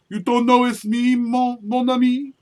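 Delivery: a light, hammed-up French accent, slow at the end; mock French accent. Imitating his friend teasing him in a mock French accent. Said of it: "He'll get all French on me".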